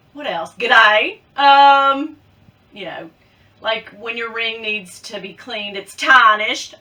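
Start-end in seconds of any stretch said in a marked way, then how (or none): 0.6-1.2 loud Aussie greeting; attempted Australian accent. Shown as a demonstration. Vlogger showing words she can say, loud Australian accent. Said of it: "I can say things like". 6.0-6.7 loud, put-on Aussie vowel; attempted Australian accent. Shown as a demonstration. Vlogger showing a word she can manage, loud Australian accent. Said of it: "I can do certain work".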